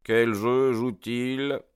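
musing repeat. A narrator's reading. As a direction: turn the question over in your mind, slowly. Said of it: "répéta mon ami en réfléchissant".

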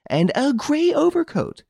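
a woman who won't back down; insistent lady. Acted. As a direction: press each word home.

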